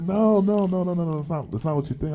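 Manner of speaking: high string of mock protests; flustered denial. Customer insisting to the cashier, flustered denial. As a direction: imitate her repeated denial, starting high and easing down. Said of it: "And she's like".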